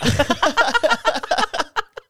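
loud, high burst of laughter. Real, not acted. Cracking up over being the same height, high loud laugh. Cracks up at own short joke.

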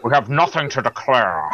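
low, slurred Scottish film-star impression; deep-pitched suave Scottish impersonation; Connery. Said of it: "See, Connery pulls those lines off".

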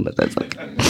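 soft, unvoiced through tears; tearful whisper. Real, not acted. Crying onstage, whispering to the audience it's fine. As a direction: barely voice it, holding back tears. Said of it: "I wasn't gonna cry this much".

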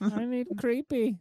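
high, slow creepy impression; mock-creepy squeal, drawn out. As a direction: stretch it high and eerie. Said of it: "your, your creepy voice".

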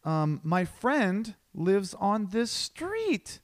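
high, drawn-out fake-casual act; exaggerated pretend coincidence; feigned innocence. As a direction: play dumb in a put-on high voice. Acts feigned casual surprise at friend.

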